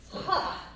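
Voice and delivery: short unvoiced ha on the beat; breathy ha. Shown as a demonstration. Teacher marks the beat for followers with a breathy ha. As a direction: puff out a little ha on three. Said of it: "I chose ha".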